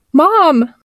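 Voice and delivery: high-pitched cry for mother; frightened call. Acted. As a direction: call out high, as a scared child. Frightened child calls for mother.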